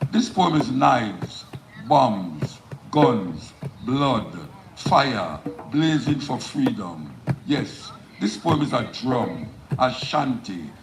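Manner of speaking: emphatic spoken-word delivery, phrases falling at the ends; poem recitation. Acted. Poet performing the poem live for an audience, declaimed. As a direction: declaim each line with weight, pausing between phrases.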